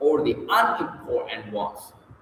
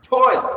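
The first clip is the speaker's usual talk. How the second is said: "choice" hit loud and much higher; stressed choice; 10 semitones higher, 7 dB louder than usual. Shown as a demonstration. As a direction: throw weight onto "choice". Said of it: "only one important word".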